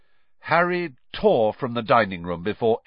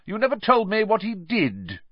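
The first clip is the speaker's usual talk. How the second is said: nosy aunt; 11 semitones higher than usual. A narrator's reading. Aunt Marge demanding.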